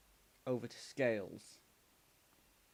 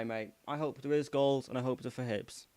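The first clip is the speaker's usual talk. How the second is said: ordinary talk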